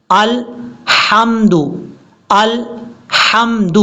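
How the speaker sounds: slow 'الحمد', split after ال; correct recitation. Shown as a demonstration. Teacher shows the right way, slow correct recitation. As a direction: hold 'الحمد' out with a fine throaty ح. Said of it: "اس طریقے سے".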